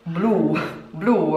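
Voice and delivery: blue said as Spanish "plu", short and falling; Spanish accent. Shown as a demonstration. Showing how Blue sounds in Spanish, Spanish accent. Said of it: "how would it sound".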